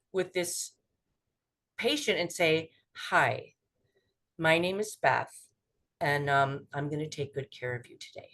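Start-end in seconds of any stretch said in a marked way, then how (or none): 3.1-8.3 low, soft, unhurried reassurance; calm, caring voice. Shown as a demonstration. Clinician introducing herself to a scared patient, low calm caring voice. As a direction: settle the patient gently, voice dropping softer. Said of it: "In that voice".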